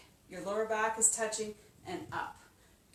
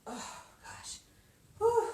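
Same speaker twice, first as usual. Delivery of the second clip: out of breath; 11 semitones higher, slower than usual. Real, not acted. Straining after a hard set.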